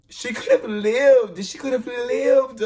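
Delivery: slow, high and loud, distressed; mourning at the screen; upset lament. Real, not acted. Reacting to the death, slow and distressed.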